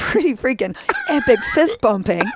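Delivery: laughing, excited and loud. Real, not acted. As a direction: laugh through the words. Fist bump laughing.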